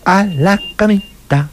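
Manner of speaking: a hint of a sleepy cartoon mouse tucking in; Topollillo impression. Echoing the host's Topollillo quote, lightly voiced slow mouse. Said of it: "Topollillo era el que decía".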